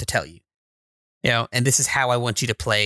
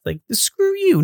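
indignant; slower than usual. Real, not acted. Outburst at designers.

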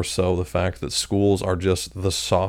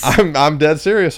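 laughing; 7 semitones higher, 8 dB louder than usual. Real, not acted. Laughs while insisting on fact.

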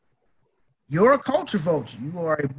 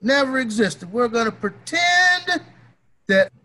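sarcastic, rising loud mock announcement; hammed-up pretend, big and high; 5 semitones higher, 4 dB louder than usual. Podcaster sarcastically pretending slavery never happened, loud and mocking.

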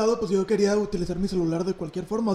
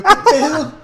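loud, high, laughing what-the-heck; laughing disbelief at the hang-up; baffled; 7 semitones higher, 12 dB louder, much slower than usual. Retold or imitated. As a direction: blurt it out stunned. Kid baffled after being hung up on.

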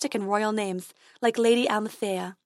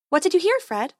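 higher, lightly voiced fairy; fairy dentist; 8 semitones higher, 3 dB louder than usual. A narrator's reading. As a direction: ask it in a high fairy voice. Fairy answers the unicorn.

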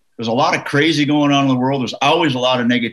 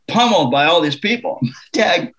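ordinary talk

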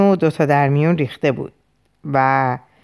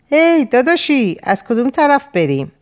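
calling out; 9 semitones higher, 3 dB louder than usual. A narrator's reading. Lost man in snow hails his brother for directions, calling out. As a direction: hail someone at a distance.

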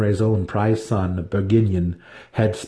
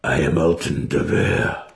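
lower, resolute declaration; proud claim; 6 semitones lower than usual. A narrator's reading. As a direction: claim the name at last. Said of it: "gaining strength from the identity".

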